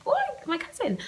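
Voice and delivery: very high excited squeal; high, thrilled greeting. Retold or imitated. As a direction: squeal it like you just spotted him. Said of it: "I was excited to see him".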